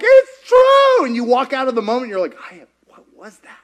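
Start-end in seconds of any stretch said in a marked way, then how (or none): 0.0-1.0 high, loud burst of conviction; exultant cry. Acted. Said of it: "in the moment you're like". 2.5-3.6 hushed, doubting afterthought; faint whisper. Acted. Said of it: "feels like a whisper".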